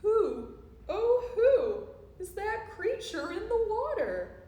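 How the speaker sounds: high, hooty storybook wondering; theatrical puzzled asking; wondering question. A narrator's reading. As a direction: stretch each "who" like a loud hoot. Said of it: "and asked them".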